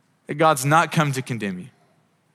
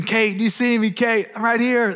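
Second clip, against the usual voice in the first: mildly urgent, raised-pitch call; calling for attention; 9 semitones higher than usual. Acted. Preacher acts out waving down Kate in a crowd, calling for attention. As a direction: call out to be noticed, higher. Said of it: "you wanna get their attention".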